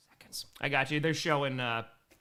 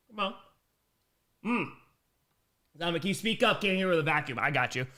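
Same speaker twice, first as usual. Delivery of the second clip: ordinary talk, nothing marked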